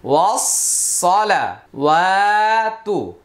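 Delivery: আত্তাহিয়াতু stretched very slowly syllable by syllable; slow, elongated recitation; broken-down slow. Shown as a demonstration. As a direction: draw out আত্তাহিয়াতু for the class. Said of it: "এখানে লম্বা হলো".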